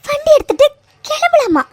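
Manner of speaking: incredulous retort, pitched high; indignant disbelief. Acted. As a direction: throw the suggestion back in disbelief. Indignant at the suggestion to leave.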